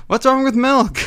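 high, laughing mock defence; laughing protest. Real, not acted. Laughing defence of his accent.

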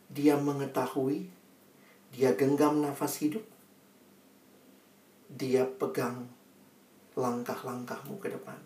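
soft, unhurried, with long pauses; gentle preaching. Real, not acted. Preacher assures congregation God knows them, soft preaching. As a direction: bring the message home quietly and intimately. Soft, solemn closing of the sermon.

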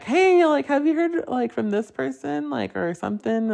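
asking mom if she heard from someone, high sing-song; retold self asking mom. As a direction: pitch way up, lightly playful asking.

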